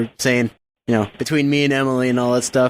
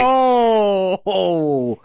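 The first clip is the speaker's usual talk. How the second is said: drawn-out, playful wounded oh; play-hurt groan of oh; mock wounded; 4 semitones higher than usual. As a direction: react as if the jab really landed. Mock hurt after a jab.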